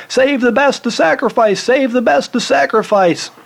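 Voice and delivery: half-hearted imitation of soldiers yelling; mock battle cry. Preacher imitates charging soldiers calling out, mild mock shout. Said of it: "are shouting to each other".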